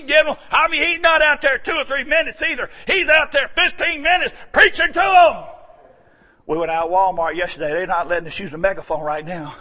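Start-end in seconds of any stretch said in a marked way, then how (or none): none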